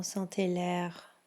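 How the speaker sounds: ordinary talk, nothing marked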